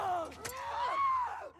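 very high gasp; shrill gasp of fright. Real, not acted. Player caught by a fast runner, shrill frightened gasp. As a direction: gasp in terror as it rounds the corner. Zombie rounds corner.

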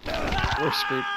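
high, loud despairing shout. Real, not acted. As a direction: shout it as all is lost. Caught by the killer.

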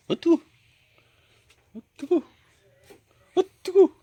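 sharp high startled yelps, really hot; burned yelp. Real, not acted. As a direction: yelp each time the heat bites. Grabbing hot potato from coals.

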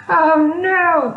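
high, loud dismayed cry. A narrator's reading. Weedle sees everyone whistling, crying out in dismay. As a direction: wail it out in alarm. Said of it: "cried the Weedle".

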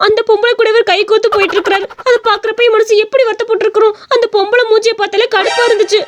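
put-on high, aggrieved girl's voice; acted wronged complaint; hurt accusation. Jealous girl accuses her uncle.

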